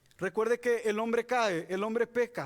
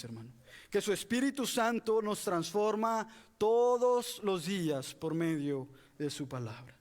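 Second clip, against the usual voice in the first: ordinary talk, nothing marked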